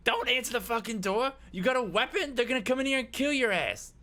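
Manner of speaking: high, loud frantic yell; shrieked urgent warning; panicked warning. Acted. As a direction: warn them off in a panic. Eddie panics at the player.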